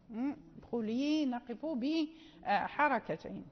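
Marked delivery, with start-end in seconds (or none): 0.6-1.3 "ادخلي" slow, long final i; ya kept at the stop, held two counts. Shown as a demonstration. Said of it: "ولكن". (الوقف على "ادخلي" بمد حركتين)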